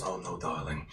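low smug refusal; deep menacing villain. Acted. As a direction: purr it like a villain toying with prey. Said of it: "he goes".

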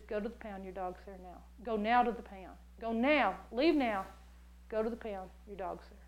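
low, quiet, deliberate command; soft, low urging to act now; God's voice. Retold or imitated. God telling her to go to the pound, low and quiet. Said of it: "He says".

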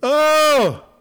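a high, loud, wailing oh; long high wail of distress; anguished moan. Acted. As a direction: cry out in anguish. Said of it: "I moaned like the morning dove".

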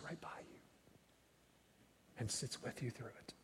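intimate whisper of comfort. Real, not acted. Preacher comforting the grieving congregation, whispered. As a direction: drop to a whisper to reassure.

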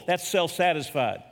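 ordinary talk

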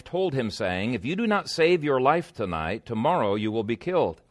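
ordinary talk

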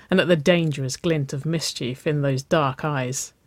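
ordinary delivery

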